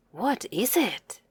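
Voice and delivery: a hushed second take of the question. Shown as a demonstration. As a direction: say it again gently and quietly. Repeating a word for the child.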